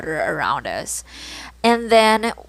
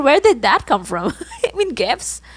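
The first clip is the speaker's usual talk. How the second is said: laughing; 6 semitones higher than usual. Real, not acted. Laughing at her own slip.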